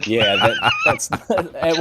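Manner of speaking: laughing. Real, not acted. Friend laughing at the paladin story, laughing. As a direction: talk through real laughter.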